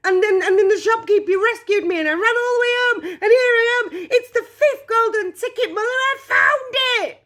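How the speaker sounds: put-on very high, loud boy tumbling over his words; breathless excited yelling. A narrator's reading. Charlie telling mother he found the golden ticket, excited yelling. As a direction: gabble it at a high-pitched yell like a hurricane. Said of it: "yelled Charlie, rushing in on 'em like a hurricane".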